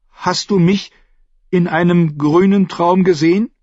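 put-on nervous young boy asking his friend. A narrator's reading. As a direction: ask nervously, dreading the answer. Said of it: "fragte er Joyen nervös".

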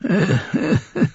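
put-on high wheezy cackle of an old lord; high old man's cackle. A narrator's reading. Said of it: "Misstrauisch blinzelte der alte Mann".

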